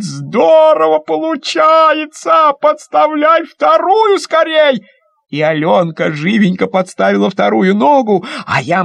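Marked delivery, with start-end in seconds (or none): 0.0-4.8 put-on much higher, slow, stretched boy's cry; excited shout. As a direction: shout in delight. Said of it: "Мишка кричит". (восторженно кричит)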